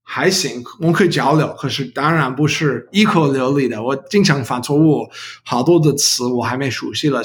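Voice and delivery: American-accented learner Mandarin; careful second-language Mandarin, English accent; foreigner speaking mandarin. Real, not acted. Non-native learner speaking Mandarin throughout.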